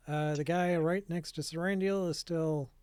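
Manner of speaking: normal talking voice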